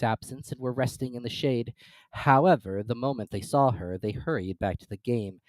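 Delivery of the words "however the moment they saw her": ordinary delivery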